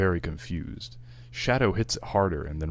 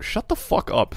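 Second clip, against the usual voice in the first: yelling; 5 dB louder than usual. Real, not acted. Players heckle the narration.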